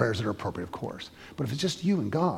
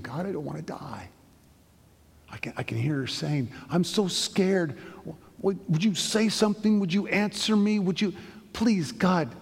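a patient alone in a hospital bed, crying out to God in fear; frightened weeping prayer; 5 semitones higher than usual. Retold or imitated. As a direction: beg God to answer, voice lifting with fear. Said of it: "I can hear her weeping".

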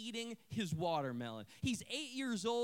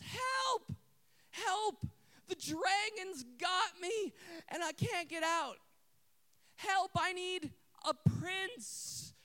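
high, drawn-out mock cry for help; imitated girl's yell; 9 semitones higher, 3 dB louder, slower than usual. Girl atop playground tower calling for a prince, imitated yell. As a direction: play a little damsel yelling for rescue. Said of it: "she yells out at the top of her lungs".

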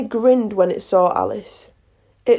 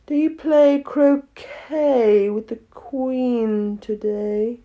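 very slow, drawn-out cat voice; Cheshire Cat, languid; much slower than usual. A narrator's reading. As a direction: linger lazily on every word. Cheshire Cat questions Alice, unhurried.

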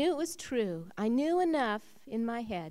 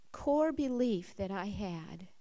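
ordinary delivery

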